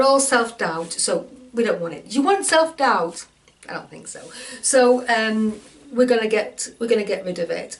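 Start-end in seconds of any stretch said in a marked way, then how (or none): 3.7-4.2 breathy whisper, dropping right away; whispered aside. Pretend. Coach whispering a dismissal of self-doubt to listeners, sly whisper.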